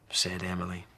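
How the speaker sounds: ordinary delivery, nothing marked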